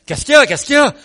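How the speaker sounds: high, urgent acted question; alarmed questioning. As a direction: ask loudly, as if rushing to a screaming neighbour. Acts out alarmed visit to screaming neighbour. (question affolée, jouée d'une voix aiguë)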